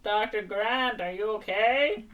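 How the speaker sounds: slow, drawn-out megaphone hail; imitated rescuer on a megaphone; megaphone call. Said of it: "a man with a megaphone".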